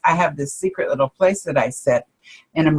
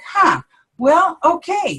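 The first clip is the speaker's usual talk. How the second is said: much higher, a bemused huh, well, okay; mock surprised shrug; 11 semitones higher than usual. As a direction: act out your own startled acceptance. Acting her reaction to the answer.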